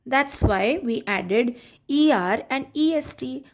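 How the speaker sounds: usual speaking voice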